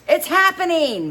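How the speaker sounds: very high, loud joyful yell. A narrator's reading. Bandmate yells that the tour is happening. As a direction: shout it to the roof. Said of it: "Max yells".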